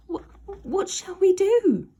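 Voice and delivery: high, loud panicked creature voice; scared Slime. Acted. Slime among the cats, high and panicked. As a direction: stammer in fright.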